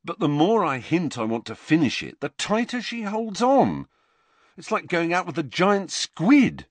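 Ron's voice. A narrator's reading. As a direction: grumble like a fed-up teenage boy. Ron complains about Lavender.

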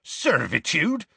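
high, drawn-out incredulous echo; scornful question, stretched and rising; scathing. A narrator's reading. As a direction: throw the word back with scorn. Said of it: "said Hagrid scathingly".

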